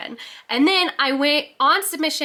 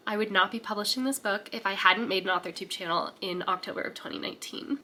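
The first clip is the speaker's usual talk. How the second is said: ordinary talk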